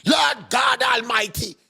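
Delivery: slow, high, shouted wail to the Lord; mock anguished cry. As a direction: ham up a pained cry to heaven. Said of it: "Don't come and talk with pain".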